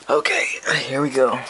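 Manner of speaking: tense whisper before the blast. Real, not acted. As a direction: breathe it out nervously. About to blow capacitor, whispered.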